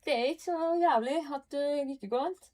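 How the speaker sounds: Trondheim dialect. Shown as a demonstration. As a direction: perform a lively Trondheim accent. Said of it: "or would you want, like Trondheim".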